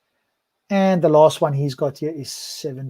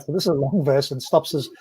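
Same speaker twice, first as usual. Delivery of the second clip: ordinary delivery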